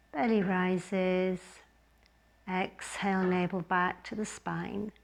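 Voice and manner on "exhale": usual speaking voice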